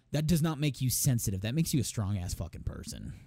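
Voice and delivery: ordinary delivery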